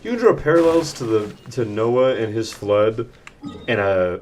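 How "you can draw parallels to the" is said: ordinary talk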